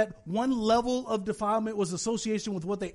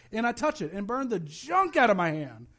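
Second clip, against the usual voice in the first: usual speaking voice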